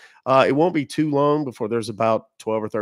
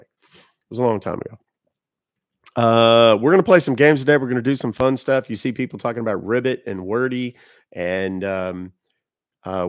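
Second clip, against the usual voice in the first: ordinary delivery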